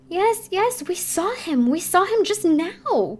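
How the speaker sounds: high, loud eager insistence; crying out. A narrator's reading. Ballet girls crying out to Sorelli, high and eager. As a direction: insist all together, breathless. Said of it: "cried the girls".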